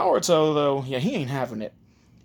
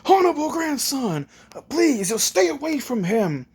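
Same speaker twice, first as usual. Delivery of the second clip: high-pitched, fussy plea; anxious urging; 11 semitones higher than usual. Acted. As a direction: implore the boy as a protective retainer. Ebisu urges boy away from Naruto.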